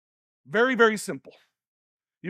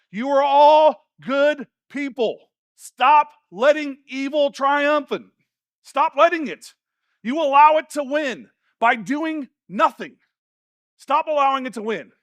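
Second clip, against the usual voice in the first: loud, higher, slow emphatic exhortation; forceful preaching; 4 semitones higher, 9 dB louder, slower than usual. Real, not acted. Preacher urging the congregation to stop letting evil win, forceful preaching. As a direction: preach it out, weight on each word.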